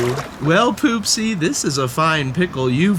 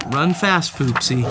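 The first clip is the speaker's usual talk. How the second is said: plain reading voice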